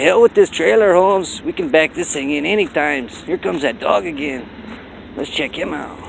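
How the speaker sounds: high, lilting mock Latin American accent; Latin American impersonation. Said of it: "That's my best Latin American impersonation".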